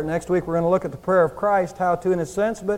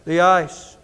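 booming voice; 3 semitones lower, much slower than usual. Retold or imitated. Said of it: "this booming voice".

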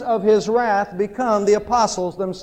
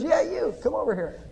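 pet-store bird; 2 semitones higher, 5 dB quieter than usual. Acted. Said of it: "Bird says".

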